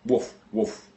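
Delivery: two short mock barks, woof woof; dog bark imitation. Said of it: "The dog barks".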